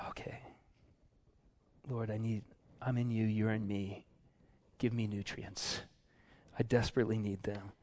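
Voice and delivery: soft, intimate plea to God; hushed, understated modelled prayer; quiet prayer. Acted. Models prayer after a sigh.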